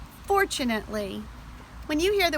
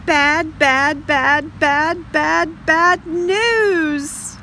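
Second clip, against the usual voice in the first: loud, high hammed-up crying wail; mock wailing; 5 semitones higher, 5 dB louder, much slower than usual. Shown as a demonstration. Teacher reading to children, exaggerated fake crying. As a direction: wail it like a tantrum.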